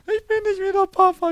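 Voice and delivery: put-on high, loud childish protest; little daughter's protest. Daughter voiced in family anecdote. (hohe, laute Kinderstimme, empört)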